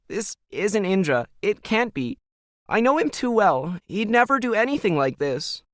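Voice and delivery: a frightened daughter blurting bad news to her mom; upset teen daughter. A narrator's reading. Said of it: "Emily protested".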